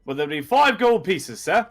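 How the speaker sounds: high, lilting street seller naming a price; overly keen hawker; emphatic map vendor. Acted. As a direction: pitch it high to a customer, with big swings.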